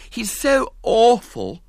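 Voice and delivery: put-on high, slow, whiny little girl; haughty girl begging, high and drawn out; pitiful pleading girl. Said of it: "she pleaded pitifully".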